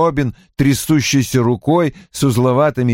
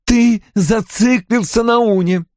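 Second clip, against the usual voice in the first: angry retort; 12 semitones higher than usual. A narrator's reading. Anna argues back at father.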